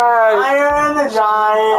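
drawn-out teasing yell; slow, loud and stubborn; playful shout. Pretend. Playful shouting match over name.

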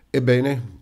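a hint of bewildered, touched old man; mild stunned prompting; dazed and moved. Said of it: "intontito e commosso".

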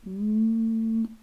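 long, loud hummed "N-" sound. Shown as a demonstration. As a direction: hold "N-" as one steady nasal hum. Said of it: "Starts with an N".

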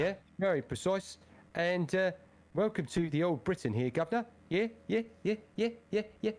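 high-pitched mock British gent; old-time English accent. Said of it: "You do a good, uh, English accent".

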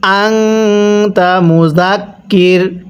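slow chant, আংতা with a long nasal hum before "ta"; drawn-out আংতা, then a stop on মুজাক্কির; ghunnah recitation. Shown as a demonstration. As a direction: hold the ng of আংতা in the nose. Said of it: "গুন্নাহ করে পড়তে হয়".

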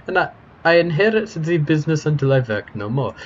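put-on eager, heavily foreign-accented chatterbox; happy foreign-accented character. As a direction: gush politely in a thick foreign accent. Voices a game character.

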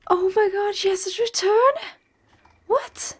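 high excited disbelief; surprised. Real, not acted. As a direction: gasp it out in delight. Surprised pet came back.

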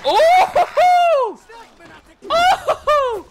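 very high, loud laughing whoop; gleeful whoop. Real, not acted. Player delighted by the fight, high gleeful whoop. Reacts to hit with glee.

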